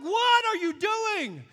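loud, high, slow disbelieving cry; startled homeowner shouting at the contractor; shocked yell. Retold or imitated. Shocked at brother kicking studs.

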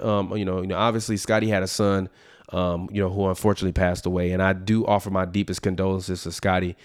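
a quiet, earnest aside offering condolences; sincere condolences. Real, not acted. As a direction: drop the voice for heartfelt sympathy. Offers condolences for Scotty's son.

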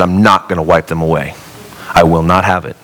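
a hint of a low, firm God; lightly voiced God, low and resolute. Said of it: "the will of God said".

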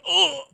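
high, sudden cry of pain; a yelp like he's just been stabbed; imitating the victim's pained yelp; mock pained yelp. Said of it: "And he's like".

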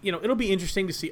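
ordinary talk

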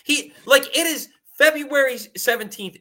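worked-up and incredulous; incredulous exasperation. Real, not acted. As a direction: protest in disbelief, voice climbing high. Incredulous rant about player staying.

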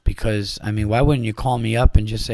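usual speaking voice

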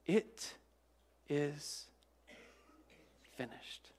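slow, fading to a whisper; Jesus's last breath from the cross, fading into a whisper; dying words. Retold or imitated. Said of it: "as he's about to give up his spirit".

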